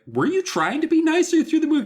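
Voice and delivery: incredulous. Retold or imitated. Mock incredulous question.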